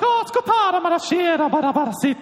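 high-pitched nonsense babble; mock foreign gibberish. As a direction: rattle off made-up words up high. Fake foreign gibberish prank, acted.

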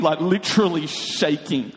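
usual speaking voice

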